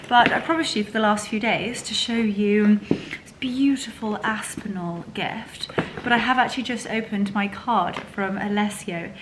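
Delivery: normal talking voice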